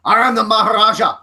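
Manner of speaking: a loud, hammed-up Indian accent for the wrestler; mock Indian accent. Podcaster mocking a wrestler's boastful promo, in a loud hammed-up Indian accent. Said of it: "I put on an Indian accent that's slightly racist".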